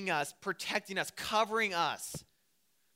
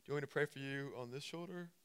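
mock pickup line; 3 semitones lower, 9 dB quieter than usual. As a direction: murmur it like a bad pickup line.

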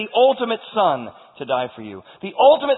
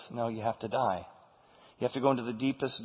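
grave refusal; 6 semitones lower, 10 dB quieter than usual. Acted. God's grave answer to pleading son.

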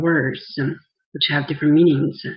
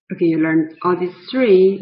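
ordinary talk

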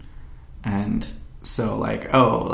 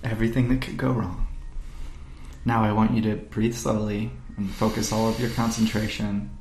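guided meditation; 5 dB quieter than usual. Pretend. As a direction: lull them gently into their fears. Mock meditation on fears.

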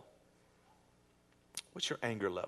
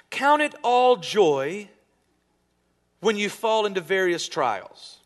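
slow, louder, higher reading of the verse; scripture reading; 5 semitones higher, 6 dB louder, slower than usual. Real, not acted. Pastor reads James to the congregation, slow and proclaiming. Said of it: "Listen to what he says".

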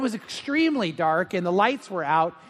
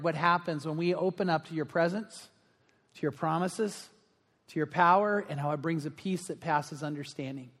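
softer, lower praying voice with long pauses; prayer; 3 semitones lower, 5 dB quieter than usual. Real, not acted. Pastor leading the congregation before God, quiet prayer. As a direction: speak to God quietly, phrase by phrase.

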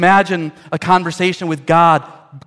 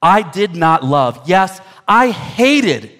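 slow, deliberate declaration; drawn-out, forceful confession; emphatic quotation; 2 semitones higher, 3 dB louder, slower than usual. Acted. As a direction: weigh each word, punching up on hated. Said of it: "Luther would write".

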